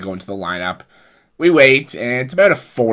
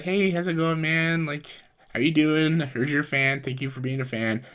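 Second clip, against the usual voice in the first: put-on raised, friendly hello to a kid; friendly greeting; 8 semitones higher than usual. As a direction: coax a nervous kid gently. Big Show greeting a shy kid.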